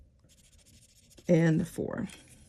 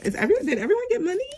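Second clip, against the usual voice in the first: quick, high, excited question; rushing to check everyone got money; 12 semitones higher, much faster than usual. Real, not acted. Budgeting vlogger checks the envelopes, quick excited rise.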